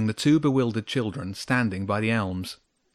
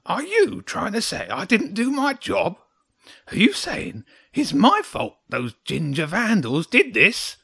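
high, loud, affronted little creature; grumpy and defensive; indignant hedgehog; 11 semitones higher, 5 dB louder than usual. A narrator's reading. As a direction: protest hotly in a high hedgehog voice.

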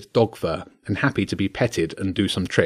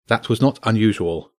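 ordinary delivery

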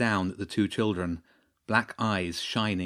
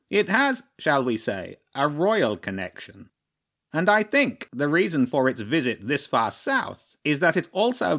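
formal crow chairman with swooping, higher pitch; crow chairman, self-important; 4 semitones higher than usual. Acted. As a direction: pronounce it like a pompous council leader.

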